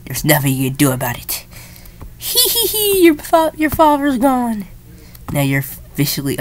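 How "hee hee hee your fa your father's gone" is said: high cackling mock psycho giggle; psycho villain. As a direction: giggle like a gleeful madman. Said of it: "I'm sounding a little bit more like a psycho".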